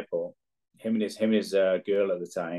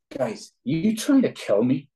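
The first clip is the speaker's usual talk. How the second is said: mock exasperated teammate; 6 semitones higher, 3 dB louder, slower than usual. Teammate voiced in story.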